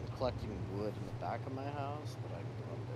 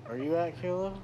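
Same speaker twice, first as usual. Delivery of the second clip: loud, higher call to a friend; yelling across the room; 9 semitones higher, 10 dB louder than usual. Real, not acted. Calls friend across the map.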